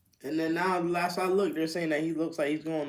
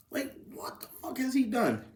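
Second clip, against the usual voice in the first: incredulous whisper; 12 semitones higher than usual. Real, not acted. Disbelief at transfer fee.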